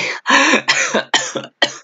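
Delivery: high airy burst of laughter; high breathy laugh. Real, not acted. Reacting to the running girl with a high breathless laugh.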